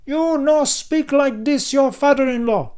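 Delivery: put-on high loud accented yell; yelled back. A narrator's reading. Narkis yelling back at Dan, outraged. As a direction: yell back in broken English. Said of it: "Narkis yelled back".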